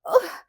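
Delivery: put-on offended girl sputtering with rage; sputtered disgusted ugh. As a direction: spit it out in disgust. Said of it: "sputtered Annabel".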